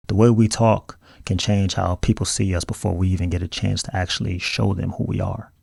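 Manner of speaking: normal talking voice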